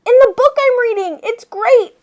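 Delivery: high, loud and fast; a girl yelling back with excitement; excited yell. Acted. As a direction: shout it back while running past. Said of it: "yelled Ellen".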